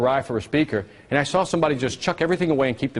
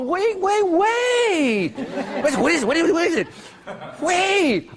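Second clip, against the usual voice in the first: very high, comic pleas to stop; mock frantic halting; 14 semitones higher, much slower than usual. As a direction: pretend the crowd is rushing you. Playfully halting an over-eager audience.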